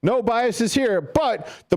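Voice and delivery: high, sing-song No biases here; mock innocent. Shown as a demonstration. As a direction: play the innocent fan, ironically. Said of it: "hypocritical statement".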